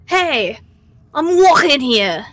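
a cabbie shouting at a car, New York style; New York accent. Retold or imitated. Retelling a near miss, friends hear a New York yell. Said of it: "In, like, the perfect New York accent".

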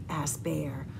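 ordinary delivery, nothing marked

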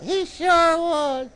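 high, slow puppet sidekick. Pretend. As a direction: chime in slowly in a high puppet voice. Puppet Rowdy confirms the story.

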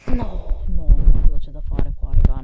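very loud frustrated no; loud protest. Real, not acted. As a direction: shout in disbelief. Level confusion outburst.

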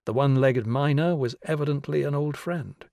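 plain reading voice

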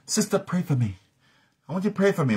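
lightly acted high-pitched plea; pleading believer. Church member asking a sister for prayer, mildly acted pleading.

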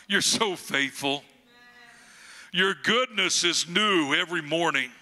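ordinary talk, nothing marked

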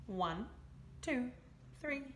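ordinary delivery

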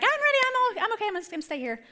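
lightly voiced teen, high and rushed; high-pitched hurried protest; reluctant daughter. Daughter insisting to her parents she'll stay home, high reluctant protest.

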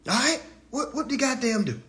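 normal talking voice

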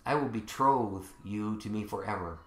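low, soft, measured scripture reading; solemn preaching. Acted. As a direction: let each phrase settle quietly, with long pauses.

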